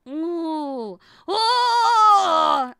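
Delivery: loud delighted ooh then oh; excited gasp. Real, not acted. Streamer thrilled by the food idea, excited squeal.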